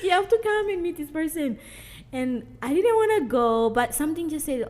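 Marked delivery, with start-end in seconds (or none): 0.0-1.6 put-on eager child's plea. Retelling son's eager plea, laughing.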